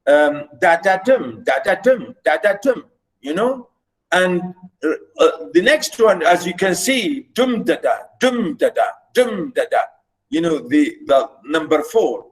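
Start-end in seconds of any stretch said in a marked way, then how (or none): 0.6-2.8 "da, da, dum" chanted over and over, weight on dum; rhythmic da-da-DUM, each group ending in a fall; anapestic rhythm. Shown as a demonstration. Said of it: "this is the musicality about it". 7.3-9.8 heavy first beat, two light falling das; dactylic rhythm. Shown as a demonstration. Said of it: "look at the opposite of number".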